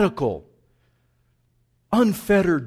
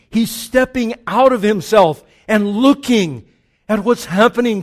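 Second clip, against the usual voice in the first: loud, driving sermon delivery; preaching at full force; 5 semitones higher, 11 dB louder than usual. Acted. Preacher describing David thrown in the water, loud and driving. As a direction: preach it hard, building.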